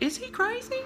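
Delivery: very high, incredulous outburst; outraged disbelief. Retold or imitated. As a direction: shriek at the absurdity of it. Outraged at the trainer's betrayal.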